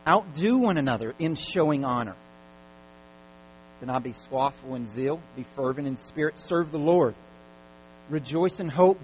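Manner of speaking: scripture reading. Acted. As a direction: proclaim the verses to the congregation. Reads scripture aloud to congregation.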